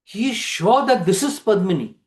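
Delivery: ordinary delivery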